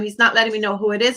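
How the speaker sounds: ordinary talk, nothing marked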